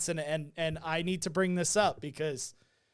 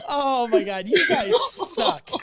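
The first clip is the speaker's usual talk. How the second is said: higher, louder, slow laughing whine; mock outrage; 5 semitones higher, 6 dB louder, slower than usual. Host fake-offended at friends' Italian jokes.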